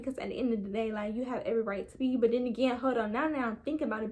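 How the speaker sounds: normal talking voice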